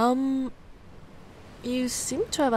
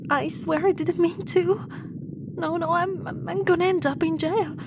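higher, stammering protest; panicky pleading; 6 semitones higher than usual. Acted. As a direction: babble in fear of jail. Pleading her innocence.

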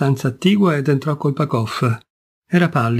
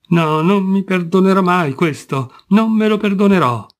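higher, louder anguished outburst; despairing cry; 5 semitones higher, 3 dB louder than usual. Acted. Kolpakov crying out his guilt, higher and louder in despair. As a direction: cry out that he will never forgive himself. Man cursing himself in despair.

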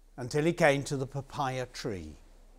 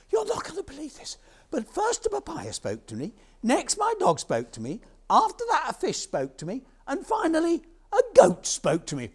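high, hurried, frantic list; panicked farmer; 12 semitones higher, 6 dB louder than usual. Acted. Farmer tells the king what spoke, high panic. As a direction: gabble it in a high voice.

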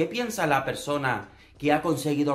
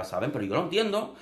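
ordinary delivery

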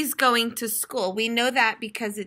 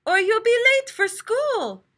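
high, louder motherly hurry; Mother Turtle voice; 11 semitones higher, 4 dB louder than usual. A narrator's reading. Mom hurrying her son off to school, high and brisk. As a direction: urge him along like a busy mom. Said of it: "said Mother Turtle".

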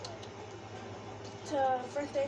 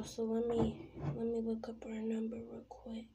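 whispering; 3 semitones lower, 10 dB quieter than usual. Real, not acted. Whispering while phoning friend.

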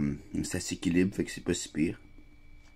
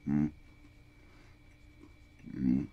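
humming while chewing; closed lips, chewing food; mouth full, chewing; 3 semitones lower, 6 dB quieter than usual. Real, not acted. Seen 0: bites cracker at -7, closed-mouth chewing through both hums.

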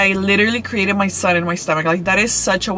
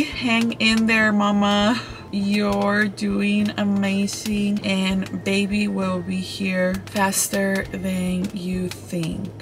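dictating slowly; 6 dB quieter, much slower than usual. Shown as a demonstration. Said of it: "put for the message".

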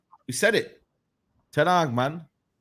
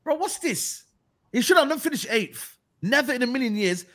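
high, indignant protest; exasperated outburst; 7 semitones higher than usual. Real, not acted. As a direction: protest in disbelief. Exasperated rant continues.

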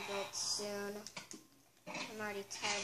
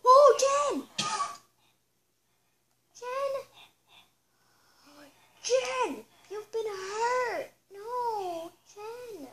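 much higher, crying out the horse's name; anguished cry; 10 semitones higher, 11 dB louder, much slower than usual. Acted. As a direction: wail in horror at the hurt pet. Finds injured horse, crying out.

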